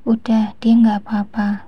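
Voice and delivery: ordinary delivery, nothing marked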